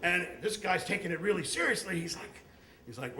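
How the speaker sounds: normal talking voice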